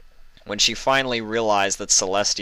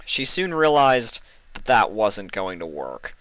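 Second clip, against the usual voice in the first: ordinary delivery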